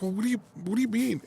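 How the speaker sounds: high, quick baffled stammer; retold flustered confusion. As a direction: act out a flustered husband.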